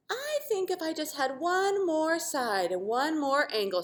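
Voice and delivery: hopeful triangle. Acted. Triangle asks for change.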